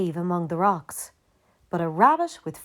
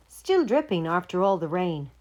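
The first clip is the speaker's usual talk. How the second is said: plain reading voice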